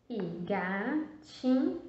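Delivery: "gatinho" drawn out slowly; stretched out. Shown as a demonstration. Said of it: "pra escrever o ti".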